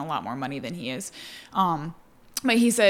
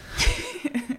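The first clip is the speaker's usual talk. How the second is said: bright burst of laughter at the joke; high laugh; 11 semitones higher than usual. Real, not acted. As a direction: laugh at your own quote. Laughs after the quote.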